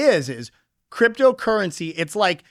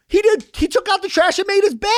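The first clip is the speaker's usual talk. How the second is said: high, whiny sibling complaint, pitched way up; aggrieved kid; 14 semitones higher than usual. Retold or imitated. Kid protesting to a parent about his brother, high and whiny. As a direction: complain like a kid cheated out of credit. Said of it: "And you're like".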